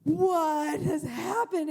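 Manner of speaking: loud, drawn-out "what has happened"; hammed-up parental horror; shocked disbelief. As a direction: stretch it out in booming, aghast shock. Parent's shocked reaction acted in story.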